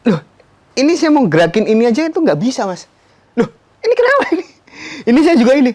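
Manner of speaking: loud, high bewildered cries; re-enacted panic. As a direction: blurt it out in mounting disbelief. Re-enacting panic at unresponsive limbs.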